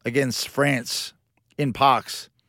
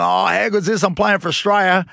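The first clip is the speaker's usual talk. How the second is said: higher, louder thrilled inner voice; excited, raised-pitch self-quote; retold elation; 6 semitones higher, 4 dB louder than usual. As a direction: relive the thrill of selection. Retold thrill at playing for Australia.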